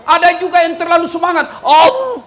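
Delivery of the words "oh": high, loud oh; mock surprised oh. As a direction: exclaim high. Mock surprise at overeager takbir. (seruan kaget dibuat-buat)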